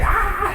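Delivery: high imitated angry scream; high pitched mock rage cry; mock yell. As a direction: let out a high furious shriek. Jessica's yell reenacted.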